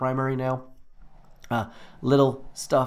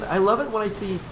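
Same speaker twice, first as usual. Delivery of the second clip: ordinary talk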